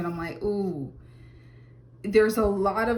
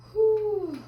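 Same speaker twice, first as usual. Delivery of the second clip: 1 high whew; 13 semitones higher, 4 dB louder than usual. Real, not acted. Reacting to a heavy card.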